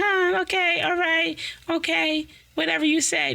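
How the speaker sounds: high, airy mock agreement; dismissive brush-off. Brushing off friends who call, airy dismissive. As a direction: brush them off sweetly.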